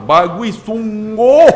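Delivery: exasperated, stretched-out sarcastic tone; scolding praise. Shown as a demonstration. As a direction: praise the child as if telling them off. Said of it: "Tapi marah tu, dentak tu".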